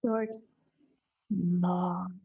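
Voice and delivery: quick short, long drawn-out long; short then long. Shown as a demonstration. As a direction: alternate a snap and a stretch. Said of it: "we have".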